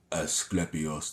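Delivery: very slow, low, soft voice; imitating game character. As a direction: draw out Asclepios in a deep, hushed impression. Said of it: "That's how he would say it".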